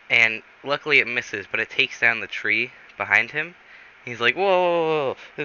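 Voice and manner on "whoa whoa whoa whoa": high run of startled whoas; urgently calling a halt, pitched up; acted alarm. Pilot after snowball fells tree, acted alarm.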